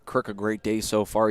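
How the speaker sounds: ordinary delivery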